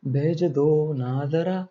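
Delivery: very slow, soft, drawn-out request; soft coaxing. Real, not acted. Teacher waiting for students to send answers, softly coaxing. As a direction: coax them quietly, stretching the words.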